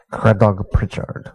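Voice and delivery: "Cradog" in two syllables, "CRAD-og"; Welsh pronunciation. Shown as a demonstration. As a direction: run the C into the r in "Cradog". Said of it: "It looks like it would be pronounced".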